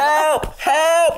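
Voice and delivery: mock cry for help.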